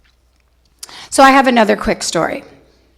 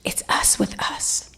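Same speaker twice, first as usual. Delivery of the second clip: whisper; 10 dB quieter than usual. Real, not acted.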